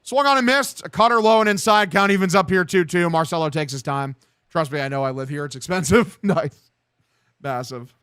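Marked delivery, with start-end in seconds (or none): 0.0-4.2 high, loud announcer call; lively baseball broadcaster; play-by-play. Acted. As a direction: sell the swing and miss like TV.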